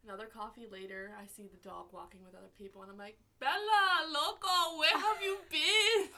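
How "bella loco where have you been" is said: high, loud, drawn-out shriek of delight; excited scream. Retold or imitated. Calling across the airport to a wiener dog, a loud delighted scream. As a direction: scream the dog's name like a long-lost friend. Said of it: "I was screaming".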